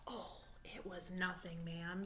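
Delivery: soft, humble brush-off; modest. Acted. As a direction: shrug it off politely. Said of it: "polite and modest frog".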